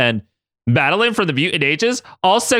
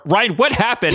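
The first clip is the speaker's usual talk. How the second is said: slightly louder; 9 semitones higher than usual. A narrator's reading. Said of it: "repeated slightly louder this time".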